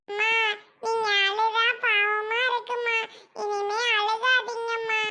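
small voice, slowing tenderly at the end; pleading little child. Acted. Child asking mother not to cry, pleading. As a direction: console mother as a sad child.

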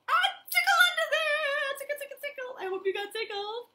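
squealing high tickle voice; giggly tickle attack. Acted. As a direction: burst into a high excited squeal. Tickling game climax.

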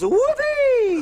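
high squealed whoopee; excited cheer. Real, not acted. Small cheering at the animal milk drinks, high whoop. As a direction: shout with glee. Delight at the recipe.

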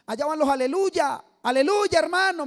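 loud, high schoolyard jeer; mock taunting shout. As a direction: yell it like kids teasing across the street. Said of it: "los amigos nos gritaban". (amigos burlándose a gritos en la calle, fuerte y agudo)

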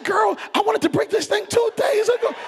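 high-pitched comic outburst; acted exasperation. Husband confessing to wife, high exasperated outburst. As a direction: burst out, voice way up.

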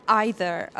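EITHER opening with an "eye" sound; British pronunciation. Shown as a demonstration. Teacher telling learners how Brits say it, "either" as EYE-ther. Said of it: "تلفظشو سخت کنن و بگن".

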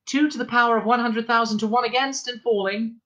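put-on high, measured announcer-like girl; sensible girl's voice. A narrator's reading. Girl's voice calling out probability odds, sensible. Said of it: "It sounded quite a sensible voice".

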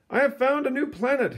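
high, proud announcement; a hint of a triumphant astronomer; announcing astronomer. Astronomer to the world, proudly announcing. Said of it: "he announced".

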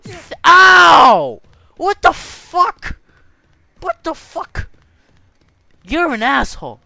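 celebrate, then yell in shock at the hit; excited then outraged. Real, not acted. Gamer cheering then punched, loud high shocked yells.

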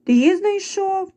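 high, slow, drawn-out squeak; loud mouse squeak. A narrator's reading. Mice answering the rooster's question, high drawn-out squeak. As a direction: pipe it up like mice in chorus. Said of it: "голосно запищали мишенята".

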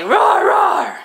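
playful high, loud dino roar; dinosaur roar. Pretend. Mom shows kids what a dinosaur says, playful roar. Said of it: "What's a dinosaur say?".

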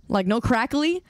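ordinary talk

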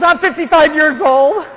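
high, loud, slow offended retort; a mock outraged woman snapping back; indignant lady. Woman asked her weight by the priest, mock indignant and loud.

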